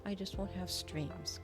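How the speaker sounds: normal talking voice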